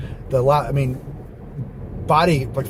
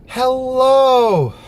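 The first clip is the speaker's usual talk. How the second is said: HELLO stretched long and very high, loud; sing-out hello held high, then dropping; long, loud high-pitched greeting; drawn-out high hello; 12 semitones higher, 6 dB louder than usual. Shown as a demonstration. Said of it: "all these different ways".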